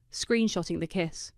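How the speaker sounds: plain reading voice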